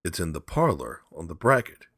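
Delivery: usual speaking voice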